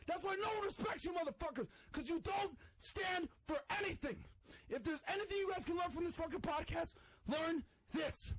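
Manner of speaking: high shouted rant; angry shouting. Real, not acted. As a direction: rant it out at everyone. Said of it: "He's super mad".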